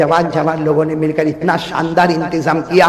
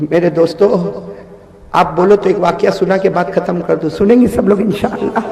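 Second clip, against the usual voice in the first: normal talking voice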